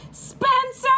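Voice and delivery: high shouted call up the stairs; yelled. Acted. Mom yelling upstairs to Spencer, high loud call. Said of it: "she yelled all the way upstairs".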